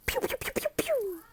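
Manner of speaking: high whispered pew pews; whispered gun sounds. Shown as a demonstration. Teacher doing the six shooter cheer, whispered pews. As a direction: fire a soft pew pew pew. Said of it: "pull your gun out".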